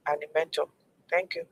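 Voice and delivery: ordinary talk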